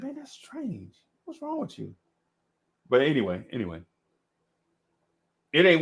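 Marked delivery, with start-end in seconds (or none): 0.0-1.9 high, soft mock bewilderment; hushed high-pitched questioning; puzzled kid. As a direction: ask it small and high, weirded out. Voicing his puzzled younger self.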